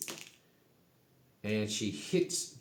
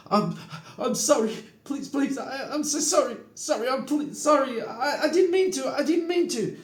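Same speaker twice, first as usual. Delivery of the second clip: very high, loud, stammering pleas; Grinwit's panicked begging; 6 dB louder than usual. Acted. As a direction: plead for your life in a squealing panic. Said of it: "immediately falls to his knees, throws up his hands".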